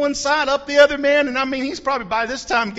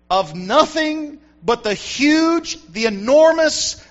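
normal talking voice

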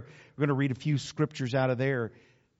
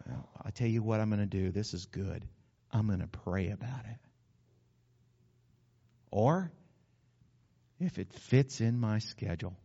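mock reluctant mumble, hedging and low; lightly voiced reluctant churchgoer; hesitant excuse-maker; 8 semitones lower, 4 dB quieter than usual. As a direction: play the halfhearted believer making excuses. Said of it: "It was more like".

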